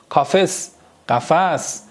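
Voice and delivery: ordinary talk, nothing marked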